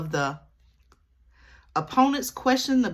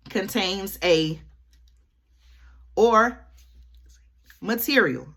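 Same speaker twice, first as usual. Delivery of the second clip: slow, halting, with long gaps where the word drops out; slow gaps around the unsaid V word; careful censored phrasing; 2 semitones higher, 3 dB louder, slower than usual. Shown as a demonstration. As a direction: leave the banned word out and pause around it. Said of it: "y'all know why I gotta say it like that".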